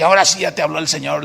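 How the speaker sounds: quick mocking retort; a touch of a smug brother; sarcastic jab. (tono burlón y rápido)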